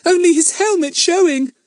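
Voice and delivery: put-on high, far child voice with a shiver; shivering little girl. A narrator's reading. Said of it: "said Betts with a shiver".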